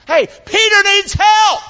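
high, slow, loud cry; impassioned shout. Real, not acted. Preacher declares that Peter needs help, impassioned shout. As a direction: shout it out to the room.

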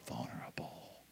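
whispered answer. Shown as a demonstration. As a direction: breathe the word like a secret. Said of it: "She made herself what?".

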